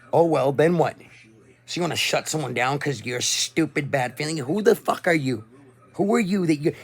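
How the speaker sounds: real furious outburst; angry rant. Real, not acted. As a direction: yell it at the people who got offended. Ranting at offended viewers.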